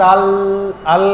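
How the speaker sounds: আল stopped on a held L; only আল, lingering on the final L; tongue held on L. Shown as a demonstration. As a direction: say আল and keep the tongue on the palate. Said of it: "জিহ্বাটা এখানে হালকা একটু লাগতেছে".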